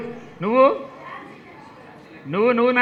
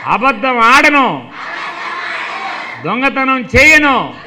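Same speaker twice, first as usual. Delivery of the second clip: slow, measured oath recital; teacher leading children in a slow pledge; 2 semitones higher than usual. Acted.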